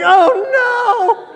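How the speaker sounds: high, loud yelp of panic; a lost kid's shrill "oh no"; panicked cry. Retold or imitated. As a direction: cry out in sudden dread. Panicking on hearing Russian.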